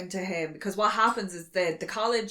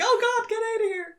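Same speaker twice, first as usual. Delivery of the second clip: high, far-off panicked squeal; hammed-up scared girl; mock freaked-out kids. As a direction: shriek like scared college kids. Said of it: "they're like".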